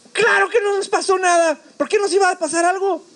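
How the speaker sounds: high-pitched indignant retort; emphatic certainty. Retold or imitated. Survivor answers his amazed friend, indignant certainty. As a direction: insist as if it were obvious, pitch way up.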